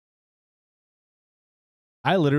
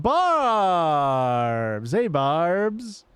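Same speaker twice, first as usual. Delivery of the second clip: calling out a name, higher and bright; excited greeting; 5 semitones higher, 4 dB louder, much slower than usual. Real, not acted. Streamer welcoming returning viewer Barbs, calling out higher. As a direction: hail the viewer joyfully.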